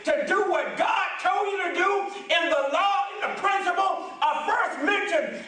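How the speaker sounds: booming motivational preaching. Acted. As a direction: preach it with fire to the congregation. Preaching at full force.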